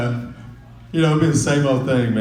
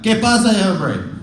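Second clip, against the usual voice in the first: put-on high, loud, slow South Texas Spanish accent; 10 semitones higher, 5 dB louder than usual. Comic showing how South Texas talks, mock Spanish accent. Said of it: "South Texas is more like".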